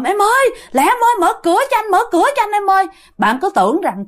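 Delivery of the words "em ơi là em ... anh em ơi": high, urgent imitation of yelling; clamorous calling. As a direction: plead at the door in a mock panicked cry. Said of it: "mà ổng la um sùm". (giả giọng la cầu cứu, cao giọng)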